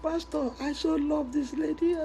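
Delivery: high, slow laughing mock sob; mock crying. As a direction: wail it like a lovesick man, half laughing. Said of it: "The brother was still crying".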